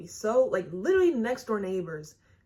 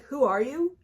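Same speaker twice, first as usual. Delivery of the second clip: retold challenge; 7 semitones higher, 6 dB louder than usual. Quoting the girls confronting her.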